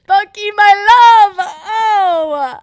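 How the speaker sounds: loud, high, drawn-out swooning cry; over-the-top lovesick shriek; adoring fangirl wail. Real, not acted. Fangirl cry to favourite character.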